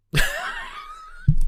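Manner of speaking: squealing high laugh; high cackling laugh. Real, not acted. Cracking up at his own Aussie bit, high laugh.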